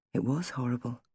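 usual speaking voice

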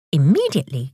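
usual speaking voice

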